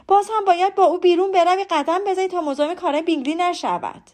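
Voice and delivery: loud, high-pitched exasperated outburst; loud complaint. A narrator's reading. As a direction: complain out loud as a fed-up mother. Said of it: "با صدای بلند گفت".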